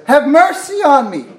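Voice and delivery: high, loud retold plea; crying out. Preacher voices blind Bartimaeus begging Jesus, crying out. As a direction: call out like a beggar pleading for mercy. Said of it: "Cries out".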